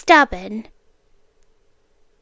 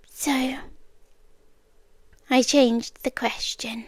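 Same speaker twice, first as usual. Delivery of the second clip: soft unvoiced whisper, owning up; whispered confession; 6 dB quieter than usual. Captor admitting the trick to captive, whispering. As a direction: breathe it out like a secret.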